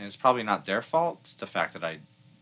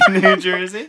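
louder, slow, laughing movie quote; Dumb and Dumber quote; 8 semitones higher, 11 dB louder, slower than usual. Acted. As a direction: laugh out the line, high and loud. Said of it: "Dumb and Dumber".

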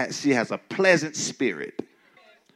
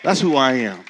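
declaring; 6 dB louder than usual. Real, not acted. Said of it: "preacher declaring himself".

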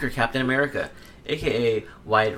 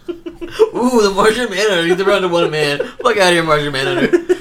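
loud, raised taunt with laughter; mock jeering; 6 semitones higher, 8 dB louder than usual. Podcaster heckling Martian Manhunter over his name, jeering. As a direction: scoff loudly, half laughing.

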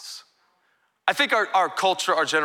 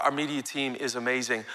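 ordinary delivery

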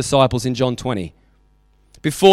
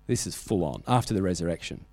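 1 hushed aside; 8 semitones lower, 9 dB quieter than usual. Real, not acted. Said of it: "dramatic hush in story".

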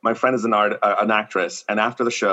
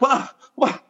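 squeaky, much higher What; high shrill repeated what; shocked disbelief; slower than usual. Retold or imitated. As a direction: jaw on the floor, voice climbing. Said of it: "being so shocked".

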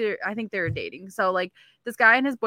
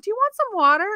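put-on high caring girl voice; 11 semitones higher, 5 dB louder than usual. Girls offering drunk guy water.

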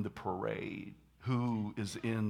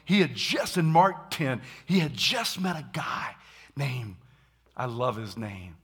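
normal talking voice

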